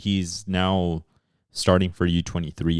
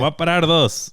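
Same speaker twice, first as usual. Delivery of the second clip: put-on high Spain Spanish accent; 10 semitones higher, 4 dB louder than usual. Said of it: "In that Spanish accent".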